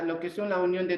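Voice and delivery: usual speaking voice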